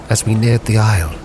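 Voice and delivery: usual speaking voice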